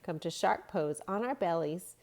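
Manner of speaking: normal talking voice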